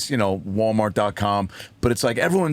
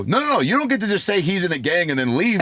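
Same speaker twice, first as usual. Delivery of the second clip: higher, emphatic mock protest; indignant protest; 9 semitones higher than usual. Comic to a man leaving mid-story, indignant protest. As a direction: cut in with a raised, insistent objection. Acted protest to departing club patron.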